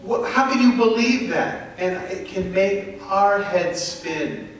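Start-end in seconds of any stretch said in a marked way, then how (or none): 0.0-1.7 a hint of a scoffing doubter, pitched up; mocking skeptic. The world taunting believers, mild mock scorn. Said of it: "the world comes to us and says".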